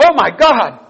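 high, loud burst of astonishment; sudden exclamation. Retold or imitated. Nurse exclaiming in the pastor's ear, sudden outcry. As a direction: exclaim it suddenly, pitch leaping up. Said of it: "said in my ear all of a sudden".

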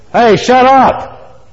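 high, loud, drawn-out acted shout; shouted shushing. Friends to the blind man, shouting him down. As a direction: holler at someone to silence him. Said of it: "all of his friends saying".

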